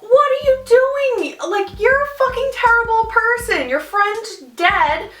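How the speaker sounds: high-pitched, incredulous scolding; retold outburst, slowing on the last words; acted outrage. Retold outrage at friend running off.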